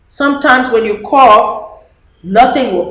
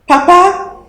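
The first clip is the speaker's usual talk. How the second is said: put-on high child's call, rising; child calling Papa; 10 semitones higher, 6 dB louder than usual. Dede calling her father in a child's voice. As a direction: call out to Papa like a little girl. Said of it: "Dede says".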